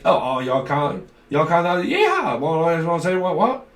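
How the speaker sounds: loud, hammed-up southern drawl. Reader mimics someone coming through in a message, mock southern drawl. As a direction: put on an exaggerated southern accent, with a whooping yeah. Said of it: "Someone's got a southern drawl accent".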